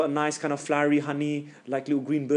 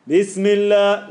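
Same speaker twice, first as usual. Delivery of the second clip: loud, slow, higher-pitched "Bismillah"; loud recitation; 5 semitones higher, 8 dB louder, much slower than usual. Shown as a demonstration. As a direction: project it fully, drawing out each syllable. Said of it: "loud with your deep voice".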